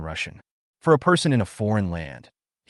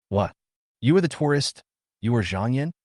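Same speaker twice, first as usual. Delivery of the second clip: ordinary delivery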